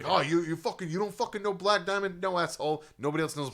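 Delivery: high, loud imitated yell at strangers; mock yelling. Rocker yelling at fans who don't know the song, high and loud. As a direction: bark at the crowd like the angry fan. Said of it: "yelling at people".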